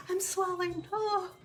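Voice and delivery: high, whimpering mock cry of alarm; mock panic. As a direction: ham up the swelling scare with a fake whimper. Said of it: "I was freaking out thinking".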